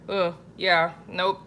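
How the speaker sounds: ordinary talk, nothing marked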